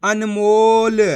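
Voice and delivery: अनमोल stretched out with a long, loud मो; blended word reading. Shown as a demonstration. Teacher showing what the letters make together, slow blending. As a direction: join the sounds slowly into अनमोल. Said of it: "तो मिलके बन जाएगा".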